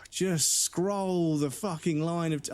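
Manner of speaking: slow, high-pitched exasperation; exasperated retort. Retold or imitated. Patient telling the doctor how to use the screen, exasperated. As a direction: drag the words out as if to an idiot.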